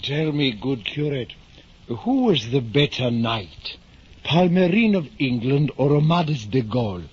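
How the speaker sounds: put-on eager old gentleman pressing the curate; earnest hidalgo demanding an answer; Don Quixote. Old knight pressing the curate, eager.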